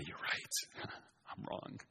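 breathy whisper breaking into a laugh. Shown as a demonstration. Child calming an angry parent, whispered with a laugh. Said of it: "be calm yourself and say".

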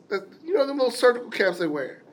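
ordinary delivery

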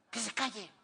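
tense, hushed telling-off; mock stern parent in church; imitated parental hushing. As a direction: scold a child under your breath. Acted parent hushing a child.

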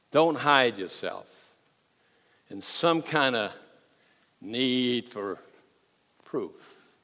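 slow, soft earnest plea; quiet pleading. Real, not acted. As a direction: urge them gently, slowly. Pastor urging congregation softly.

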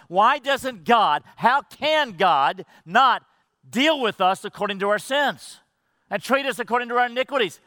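preaching at full force; rising preaching. Acted. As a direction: build to the question. Preacher builds to a climax.

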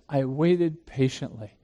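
I waited patiently said slowly and softly, each word weighted; slow, soft emphasis. Shown as a demonstration. Preacher dwelling on David's prayer, slow and soft. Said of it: "the emphatic nature of waiting".

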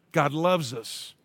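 ordinary talk, nothing marked

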